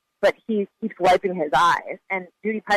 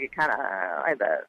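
imitating a very low, creaky fry; vocal fry; 11 semitones lower, 4 dB quieter than usual. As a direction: drop right down into a mumbly fry. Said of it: "like a fry in his voice".

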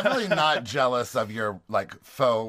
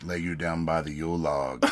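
deep sultry voice; 8 semitones lower than usual. Shown as a demonstration.

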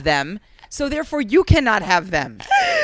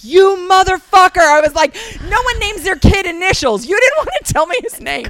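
high, loud furious yell; mock outrage; 11 semitones higher, 8 dB louder than usual. Yelling at the husband over the stolen name, mock furious. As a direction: blow up at the betrayal.

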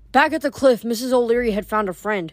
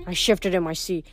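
usual speaking voice

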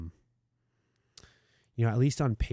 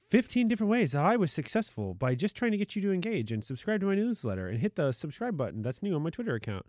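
high, hammed-up hype voice; mock clickbait voice; 7 semitones higher, faster than usual. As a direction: parody a guru's Twitter thread. Mocking Twitter thread clickbait.

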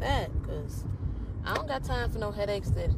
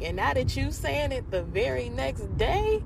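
normal talking voice

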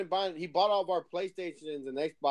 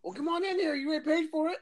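high, cheery, welcoming; high friendly voice; 11 semitones higher than usual. Retold or imitated. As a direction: wave them in cheerfully, high. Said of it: "Yeah, yeah".

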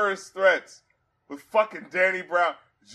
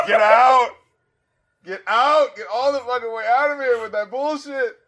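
loud, higher mock "get out"; mock outrage; 5 semitones higher, 5 dB louder than usual. Host shouting down a bad lyric, mock outrage. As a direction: throw the line out in disgust, louder.

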